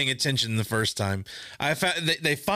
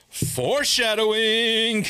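knowing remark; 5 semitones higher, much slower than usual. Retold or imitated. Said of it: "And I was like".

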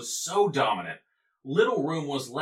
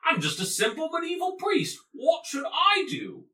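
high, shouted Cockney plea. Pretend. Priest shouts his helpless plea to the bishop in Cockney. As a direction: throw your hands up and yell it in Cockney. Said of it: "everybody talked with a Cockney accent".

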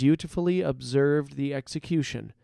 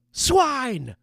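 high-pitched crowd cry; mob reply; 12 semitones higher, 4 dB louder than usual. A narrator's reading. Outcasts answer their leader, high mob cry. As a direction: cry it back as a rowdy gang. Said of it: "replied the outcasts".

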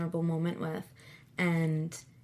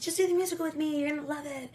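coaxing invite; 8 semitones higher, much faster than usual. Retold or imitated. Coaxing cousin into the musical.

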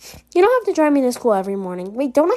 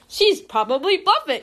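stiff robotic monotone; 5 semitones higher than usual. Retold or imitated. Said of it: "He looks like a robot".

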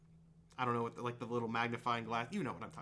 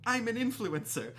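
higher-pitched influencer character; podcast character; 7 semitones higher than usual. Retold or imitated. Player quoting a podcast clip of a shop character, higher-pitched imitation. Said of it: "one of their characters in a shop".

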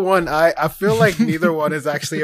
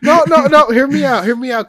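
loud, high, eager plea; excited; 8 semitones higher, 9 dB louder than usual. Real, not acted. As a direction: insist they hear you out. Pleading excitedly to be heard.

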